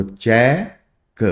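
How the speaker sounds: चेक drawn out slowly, vowel long; long vowel demo. Shown as a demonstration. Teacher repeating चेक slowly for students. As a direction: hold चेक's vowel. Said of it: "sound लंबी जा रही है".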